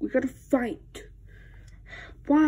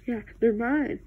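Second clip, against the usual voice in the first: usual speaking voice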